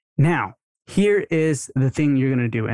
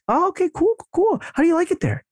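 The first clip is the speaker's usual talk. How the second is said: much higher, chirpy cool-cool-cool then the question; upbeat casual; faster than usual. Shown as a demonstration. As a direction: keep it light and interested. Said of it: "that's what you wanna say".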